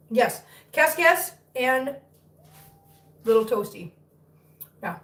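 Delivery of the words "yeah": ordinary delivery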